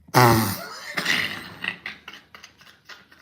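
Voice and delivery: a sudden loud burst of real laughter. Real, not acted. Streamer reading a chat comment about a Trump live stream, loud laugh. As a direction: laugh out loud, breathy and long.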